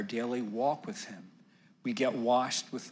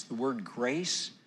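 usual speaking voice

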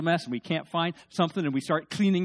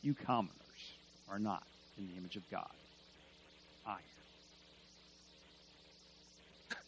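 soft, cold, aloof king's voice, much quieter; haughty pagan king; 8 semitones lower, 17 dB quieter, faster than usual. Acted. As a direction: speak down to the commoners like an arrogant king. Pagan king addressing the commoners.

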